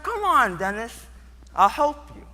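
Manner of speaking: high, insistent urging with a lifted ending; coaxing girl. Acted. Stacy urging scared Dennis to skate, high and insistent. Girl urges him again after refusal.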